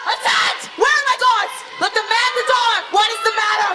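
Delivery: the king bellows for his guards in alarm; alarmed shout. Acted. King shouting for his guards, alarmed.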